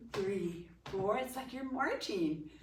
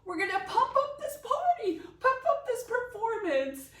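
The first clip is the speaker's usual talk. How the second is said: bright, high, excited teacher voice; excited cheer; 11 semitones higher, 3 dB louder than usual. Shown as a demonstration. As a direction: pump up the room with energy. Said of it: "we are going to do what's called pump it up".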